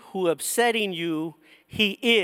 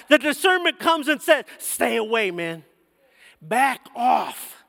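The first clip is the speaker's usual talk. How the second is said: raised, ringing preacher voice, pushing each line; preaching at full force; 4 semitones higher than usual. Acted. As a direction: preach it out to the church with fire.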